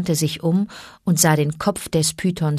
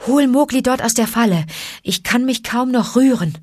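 mildly strained, higher-pitched plea; groaning; 6 semitones higher than usual. A narrator's reading. Exhausted panther asks snake to free the boy, groaning. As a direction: groan it out, worn out. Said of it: "ächzte Bagheera".

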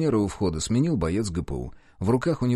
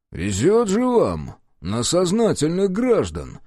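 slow, higher sarcastic jab; ironic remark; 7 semitones higher, slower than usual. A narrator's reading. Detective mocking the police chief, slow sarcasm. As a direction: lean on the irony. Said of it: "иронично заметил Иван Никитович".

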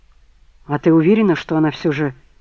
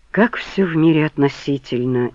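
plain reading voice

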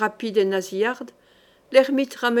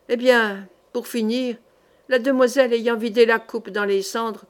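plain reading voice

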